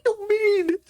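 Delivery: squeaky disbelief. Real, not acted. Reacting to the movie, he squeaks outraged disbelief.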